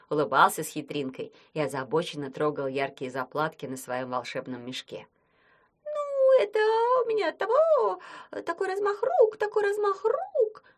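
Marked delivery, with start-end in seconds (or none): 5.8-10.6 much higher flustered squeak; squeaky mumbling. Acted. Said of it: "забормотал оборотень".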